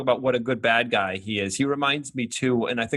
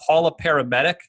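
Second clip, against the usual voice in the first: higher, louder mock call for help; imitated yell; 5 semitones higher, 6 dB louder than usual. Retelling a bystander in the movie, mock yell. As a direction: call it out as a bystander, half-serious. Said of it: "someone in the background yells out".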